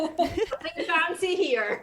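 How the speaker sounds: slow, high, loud laughing call; laughing nothing fancy here; laughing shout. Real, not acted. Laughing shout about home booth.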